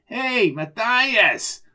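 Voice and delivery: high, drawn-out greeting shout; slow, high-pitched holler; hailing call. Acted. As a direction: call across a lobby. Arnie hails him entering the lobby.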